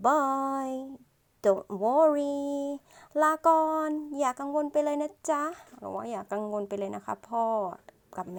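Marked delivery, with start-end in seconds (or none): 0.1-5.4 higher, drawn-out acted farewell; boy's reassuring goodbye. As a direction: play the boy telling parents not to worry. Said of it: "เขาก็พูดว่า".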